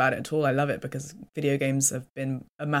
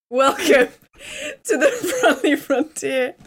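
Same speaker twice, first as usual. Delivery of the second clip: laughing welcome; 12 semitones higher, 6 dB louder, slower than usual. Real, not acted.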